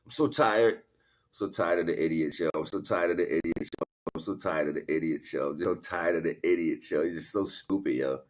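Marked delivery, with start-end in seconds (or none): none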